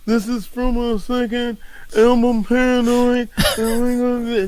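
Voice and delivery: mock rock-star intro at a gig. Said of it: "I wanna hear [name] sing".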